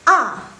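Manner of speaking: A in Mandarin fourth tone, a forceful fall. Shown as a demonstration. Teacher showing students tone four, sharp falling A. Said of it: "yang keempat".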